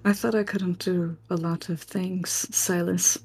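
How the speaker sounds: soft, measured in-character threat; quiet threat. Acted. Hero warns a villain held at blade's point, quiet threat.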